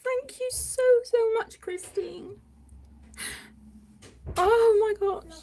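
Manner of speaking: real tears close to breaking; tearful thanks. Real, not acted. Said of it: "I'm a blubbering mess".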